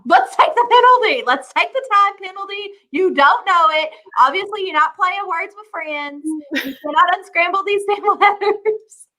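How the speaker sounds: high, exasperated rant; impatient teammate. Pretend. Imagined teammate demanding the time penalty, high and exasperated. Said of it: "I'd be like".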